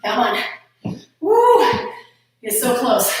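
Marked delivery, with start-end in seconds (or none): 1.2-1.6 excited whoop. Real, not acted. Coach cheering on the class.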